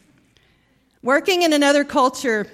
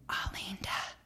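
a hushed breathy Alinda; whispered; 3 semitones lower, 15 dB quieter than usual. Retold or imitated. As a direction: whisper Alinda as a polite secret. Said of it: "and he whispered, like my mom did".

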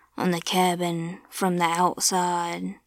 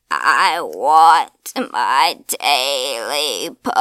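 low, slow, louder demand; strange creature voice; 5 semitones lower, 7 dB louder, much slower than usual. A narrator's reading. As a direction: push it out low and loud. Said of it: "said the strange voice".

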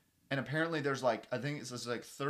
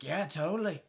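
hammed-up Australian accent, getting louder and higher; 7 semitones higher, 15 dB louder than usual. Australian friend pulling her leg, mock Australian accent. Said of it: "He's like".

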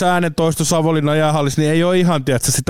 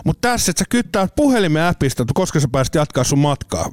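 ordinary talk

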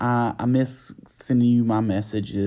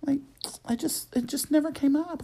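much higher, airy imitation of the friend; mock friend voice. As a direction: mimic the friend's sheepish excuse, pitched way up. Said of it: "and she's like".

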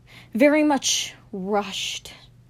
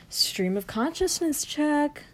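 higher slow whisper, "check" stressed; 6 semitones higher, slower than usual. Shown as a demonstration. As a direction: whisper it up high, ending on "check". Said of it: "whispered repeat, higher".

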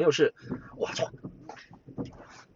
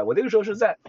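normal talking voice

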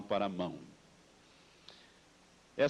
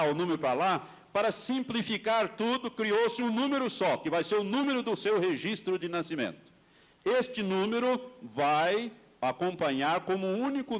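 preaching at full force; 4 semitones higher than usual. Acted. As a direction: preach it with rising fire. Sermon rising to full force. (pregação inflamada)

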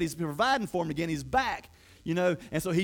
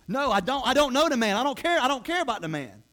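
higher, louder frantic denial; desperate denial; 6 semitones higher, 4 dB louder than usual. Retold or imitated. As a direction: push him away in a panic, voice climbing. Peter's panicked third denial.

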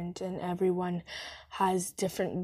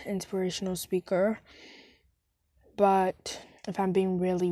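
ordinary delivery

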